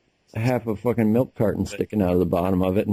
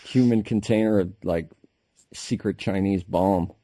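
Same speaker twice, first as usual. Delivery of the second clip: ordinary talk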